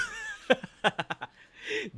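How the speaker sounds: high giggling burst; bubbly high laughter; cracking up. Real, not acted. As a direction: laugh hard at your own joke. Cracks up after own bit.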